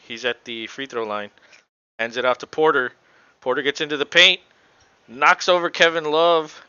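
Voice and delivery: live play-by-play. Real, not acted. Commentator calls Denver's possession live, building play-by-play. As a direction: call each play as it unfolds, building to the foul.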